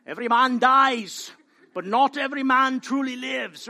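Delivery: loud, soaring battle cry, a hint of a Scot; rally the troops like Braveheart's hero; William Wallace line. Preacher quoting Braveheart to his congregation, rousing loud battle cry. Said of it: "I can't really do a Scottish accent".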